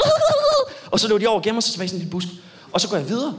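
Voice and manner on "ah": even higher, louder shriek; girl's scream. Retold or imitated. Comedian imitating the girls running off, high shriek. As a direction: squeal like a startled kid. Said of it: "så er de sådan".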